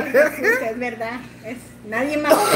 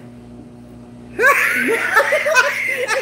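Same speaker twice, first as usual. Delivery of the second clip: high, loud burst of laughter; real shrieking laugh; 11 semitones higher, 6 dB louder than usual. Real, not acted. Laughing at the friend's reaction. (carcajada aguda y fuerte)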